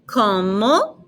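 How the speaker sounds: very drawn-out, syllables apart, rising; slow teaching model. Shown as a demonstration. Teacher models asking a name, very slowly in pieces. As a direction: break it up with long pauses.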